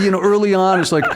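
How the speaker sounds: normal talking voice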